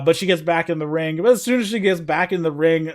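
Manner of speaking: ordinary talk, nothing marked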